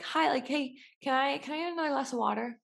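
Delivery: a touch of chirpy customer; polite customer. Retold or imitated. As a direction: sweetly ask for water. Customer asking waiter, acted.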